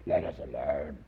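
a low, lightly put-on demon voice; demonic voice. Preacher trying a low demon voice for the man. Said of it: "Was it a demonic voice?".

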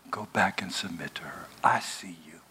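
soft unvoiced whisper; whispered voice of God. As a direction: whisper it gently as God to Hagar. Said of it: "God whispers to Hagar".

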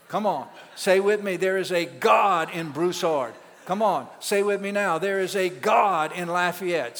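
loud, fervent call to repeat; rally the church to shout it back; preaching at full force. Real, not acted.

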